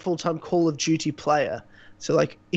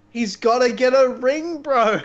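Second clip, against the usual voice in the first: higher, louder, slower mock; 6 semitones higher, 3 dB louder, slower than usual. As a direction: drag it out mockingly. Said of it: "repeated mockingly".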